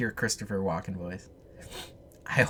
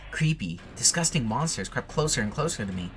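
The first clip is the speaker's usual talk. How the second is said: ordinary talk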